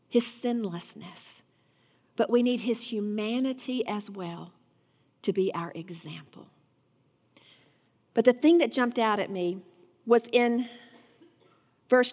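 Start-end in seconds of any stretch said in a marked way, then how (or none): 5.2-6.5 trailing into a whisper; soft whisper. Acted. Preacher closes the point on Jesus' humanity, whispering. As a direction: let it fall to a hush.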